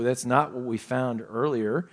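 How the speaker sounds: ordinary talk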